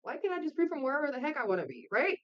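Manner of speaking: ordinary talk, nothing marked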